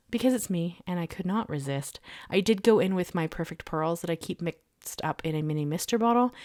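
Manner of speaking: ordinary delivery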